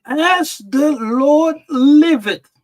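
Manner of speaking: As the Lord liveth, proclaimed high and loud, slowly; reading David's oath. Acted. David swears to Nathan, read out high and loud. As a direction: proclaim it like an angry king swearing. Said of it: "And David's anger was greatly kindled".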